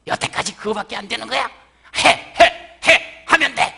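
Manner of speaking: loud, high "해, 해, 해! 하면 돼!"; harsh pushy yell. Acted. Harsh God pushing a failing believer, loud angry yelling. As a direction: push and bark like a drill sergeant. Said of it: "윽박지르거나 막 푸시하지".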